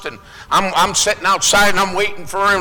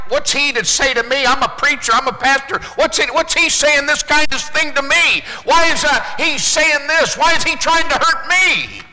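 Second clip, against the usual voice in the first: indignant; 6 semitones higher than usual. Retold or imitated. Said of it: "if I looked at it like".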